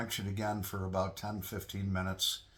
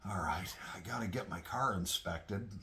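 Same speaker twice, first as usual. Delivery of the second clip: whispered thought. Retold or imitated.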